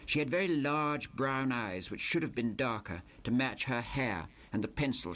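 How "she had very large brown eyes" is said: usual speaking voice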